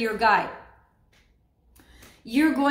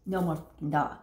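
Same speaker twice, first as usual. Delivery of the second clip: low, quiet grumbling crocodile; 8 semitones lower, 7 dB quieter than usual. Retold or imitated. Crocodile finally snapping, low and quiet. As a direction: mutter it as the fed-up crocodile.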